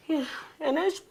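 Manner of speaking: usual speaking voice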